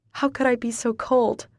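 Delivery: ordinary delivery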